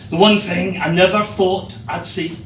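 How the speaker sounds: ordinary delivery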